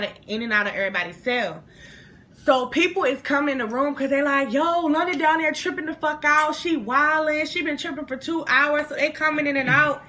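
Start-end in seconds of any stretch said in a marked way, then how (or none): 4.5-8.9 higher-pitched imitation of excited gossiping; acted-out amazed report; retold gossip. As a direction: spread the news as an onlooker would. Others spreading word of her meltdown.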